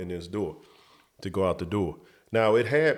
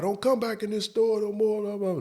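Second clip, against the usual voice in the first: retold yell; 12 semitones higher than usual. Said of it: "yells over at the guy".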